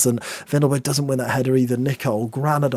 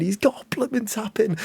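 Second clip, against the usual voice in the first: excited; 10 semitones higher than usual. Real, not acted.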